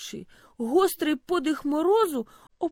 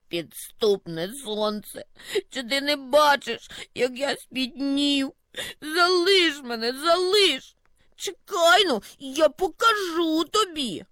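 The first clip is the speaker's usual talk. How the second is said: put-on melting old snowdrift; 4 semitones higher than usual. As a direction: whine and threaten as you melt. (старий замет, що тане, скаржиться)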